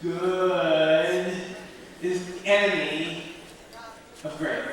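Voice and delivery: slow, drawn-out GOOD, then a pause; deliberate, spaced-out phrasing. Shown as a demonstration. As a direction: stretch it out for slow listeners, pausing between phrases. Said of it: "So I'll say it slow".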